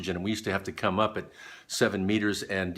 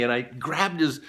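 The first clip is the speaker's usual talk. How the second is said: normal talking voice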